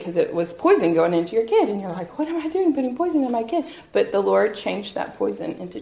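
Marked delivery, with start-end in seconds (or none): 2.2-3.6 mock alarm. Parent voiced questioning the chemo.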